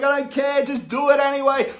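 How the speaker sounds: high, loud, bossy and jokey; dad egging his daughter on, loudly; playful insistence. Retold or imitated. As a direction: push her on with a cheeky shout. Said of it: "I'm like".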